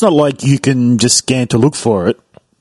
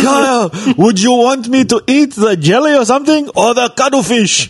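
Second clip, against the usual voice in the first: high shouted voice, much higher; high shouted Japanese man impression; 9 semitones higher than usual. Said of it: "And he's like".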